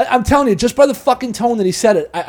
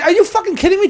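much higher, disbelieving outburst; incredulous; 11 semitones higher than usual. Retold or imitated. Retelling his reply to the comic, high incredulous disbelief. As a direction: act stunned at the nerve of him. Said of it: "I was like".

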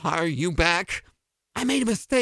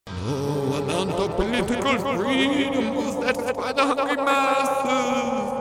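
Lenin ghost voice; 4 semitones higher than usual. Acted.

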